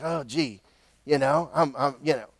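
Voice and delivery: deflated Jonah muttering to himself; sheepish mumble. Acted. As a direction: mumble like a prophet caught out. Jonah sulks, embarrassed.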